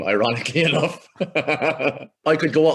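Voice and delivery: slow, laughing start. Real, not acted. He laughs as he starts to talk about America, slow and laughing. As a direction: laugh through the words.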